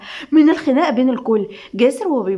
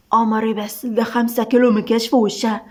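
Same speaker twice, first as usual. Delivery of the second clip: ordinary delivery, nothing marked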